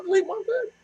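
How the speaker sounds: very high mimicking voice; parroting her phrase. Telling R R the phrase again, high-pitched parroting. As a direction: squeak it back in mock innocence. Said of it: "I said".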